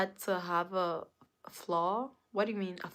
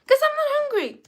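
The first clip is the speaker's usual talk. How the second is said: exasperated high protest; 9 dB louder, faster than usual. Real, not acted. Streamer explaining to viewers why cooking annoys her, high exasperated cry. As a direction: pitch leaping way up, loud.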